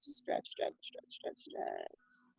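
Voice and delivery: soft unvoiced whispered counting; hushed stretch cues, no voice at all. Shown as a demonstration. Guiding a stretch to the left.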